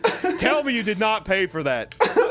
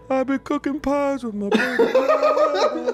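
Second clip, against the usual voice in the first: high, slow, sing-song mock voice; mock sweet voice; 10 semitones higher, slower than usual. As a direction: coo it like a doting mother, higher and slower. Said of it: "and you're just like".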